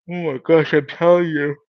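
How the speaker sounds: talking through a yawn; yawn-stretched words. Real, not acted. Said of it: "I'm not sure why I'm yawning".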